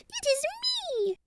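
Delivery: very high, soft mouse squeak; tiny squeaky voice; teeny tiny squeak. A narrator's reading. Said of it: "a teeny tiny voice squeaked".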